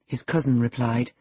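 ordinary delivery, nothing marked

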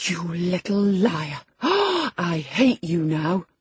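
slow, breath-caught fury; panting rage. A narrator's reading. Young lady red with passion at her cousin, panting angrily. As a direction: gasp between furious words. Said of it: "she panted, and her face grew red with passion".

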